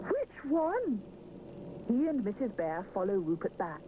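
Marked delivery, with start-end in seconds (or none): 0.1-1.0 flustered baker. A narrator's reading. Mister Sponge voiced.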